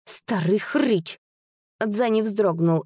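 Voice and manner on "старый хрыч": slow, hissed-through-teeth insult; through clenched teeth. A narrator's reading. Sister adds an insult aside, through clenched teeth. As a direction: squeeze each word out between the teeth. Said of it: "добавила сквозь зубы еле слышно".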